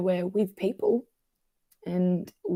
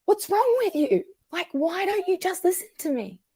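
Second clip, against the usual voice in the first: acted-out yelling; 11 semitones higher than usual. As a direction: plead angrily in a much higher voice. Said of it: "yell at them and go".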